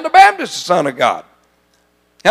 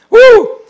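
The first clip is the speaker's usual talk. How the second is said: high, loud praise whoop; joyful woo shout; 9 dB louder than usual. Real, not acted. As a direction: let out a church whoop.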